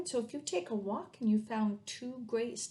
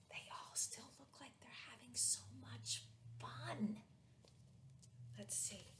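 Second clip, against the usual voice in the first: whisper; 3 semitones higher, 16 dB quieter than usual. Real, not acted. Aside while children watch.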